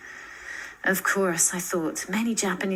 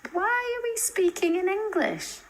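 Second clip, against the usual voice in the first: put-on high, puzzled girl's voice; lightly voiced teen girl, a bit suspicious; frowning girl's question; 14 semitones higher than usual. Kiko asking the cousins about English, puzzled and high.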